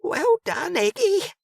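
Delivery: very high, thrilled cry; excited breathy call. A narrator's reading. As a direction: squeal it with delight. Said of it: "excited and breathy".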